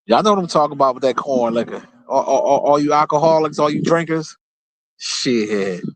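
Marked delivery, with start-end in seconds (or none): none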